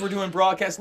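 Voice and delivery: ordinary talk, nothing marked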